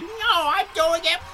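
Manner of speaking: high squeaky quote; mock high Cena. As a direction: pitch up mockingly. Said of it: "And Cena's like".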